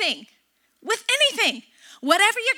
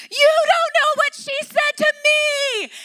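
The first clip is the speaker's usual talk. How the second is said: imitating sobbing child; 11 semitones higher, 6 dB louder than usual. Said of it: "and she's sobbing, crying".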